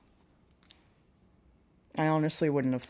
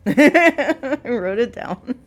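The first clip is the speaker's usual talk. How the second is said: loud, slow, laughing through it; 6 semitones higher, 17 dB louder, much slower than usual. Real, not acted. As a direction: crack up while repeating. Laughing loud drawn-out repeat.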